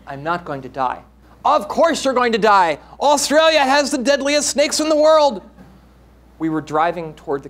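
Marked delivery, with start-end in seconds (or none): 1.4-5.4 raised, higher, louder worried mother; mother scolding son, pitch climbing; exasperated mother. Acted. Mother arguing with teenage son, loud and rising.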